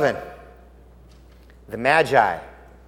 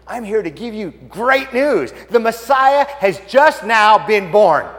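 slow, higher, building proclamation; angel's joyful announcement; 4 semitones higher, 4 dB louder, slower than usual. Acted. Angel telling the shepherds the Messiah is born, proclaiming. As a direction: announce great news, drawing it out. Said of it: "And the angel says".

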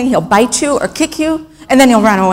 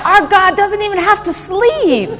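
excited proclamation; 8 semitones higher than usual. Retold or imitated. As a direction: echo the boy's excitement at full voice. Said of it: "'Cause he's excited".

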